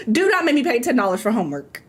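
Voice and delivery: usual speaking voice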